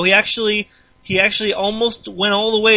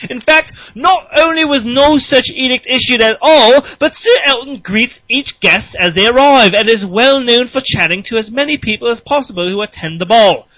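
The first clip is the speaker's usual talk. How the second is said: higher, louder posh British solicitor; British accent; 5 semitones higher, 6 dB louder than usual. Acted. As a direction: read it like a pompous British lawyer. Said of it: "it's Britain, so we gotta talk like that".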